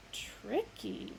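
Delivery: whispered, soft; hushed verdict after reflection; thoughtful hush. A narrator's reading. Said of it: "he said finally".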